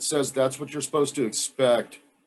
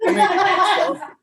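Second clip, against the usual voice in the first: much higher, louder laughing squeal; 6 dB louder than usual. Real, not acted. Squeals before eating chip.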